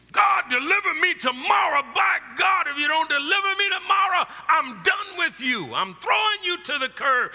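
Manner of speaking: shouting high and loud at God, hammed-up; mock angry demand. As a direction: bark the ultimatum like a spoiled child.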